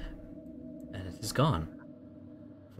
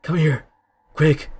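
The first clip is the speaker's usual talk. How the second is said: urgent, gruff, higher and louder; 7 semitones higher, 7 dB louder than usual. Acted. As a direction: hurry them over, rough and pressing. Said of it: "a gruff voice ask".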